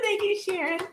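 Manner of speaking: high, giggly thanks; real laughter; laughing at herself. Real, not acted. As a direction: thank her through laughter. Laughing at her mistake.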